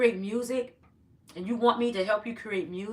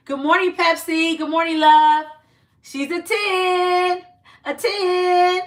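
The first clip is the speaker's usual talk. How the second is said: excited greeting; 9 semitones higher, 9 dB louder, slower than usual. Real, not acted. Greeting viewers in live chat.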